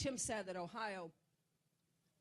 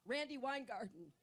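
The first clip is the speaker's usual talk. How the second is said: ordinary talk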